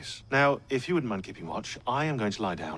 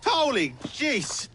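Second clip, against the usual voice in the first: high, slow startled exclamation; 3 dB louder, much slower than usual. Acted. Assassin startled by an old friend appearing, surprised. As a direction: jump at the surprise.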